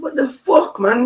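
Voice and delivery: high-pitched retold protest; reliving the bewilderment; acted disbelief.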